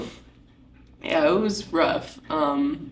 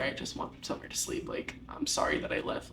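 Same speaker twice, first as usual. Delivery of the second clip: weary plea; 4 semitones lower, 11 dB quieter than usual. Retold or imitated. Broken plea to dad.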